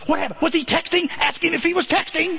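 high-pitched imitation of a nagging wife; pressing wife. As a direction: mimic a wife firing urgent questions.

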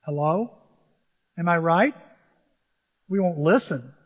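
normal talking voice

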